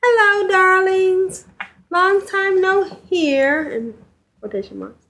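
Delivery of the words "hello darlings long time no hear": slow, high sultry read of the letter; femme fatale. Acted. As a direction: purr it like a glamorous diva. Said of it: "Juno writes".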